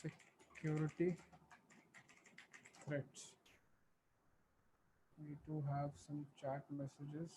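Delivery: very soft, faint voice; speaking too quietly to hear; barely audible. Real, not acted. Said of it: "your voice is very low".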